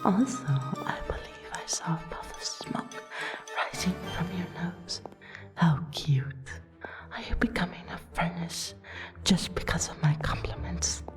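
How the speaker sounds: soft, half-whispered flirting; flirtatious teasing. Acted. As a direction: tease your dance partner quietly and intimately. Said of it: "Teasing you makes it all the more fun".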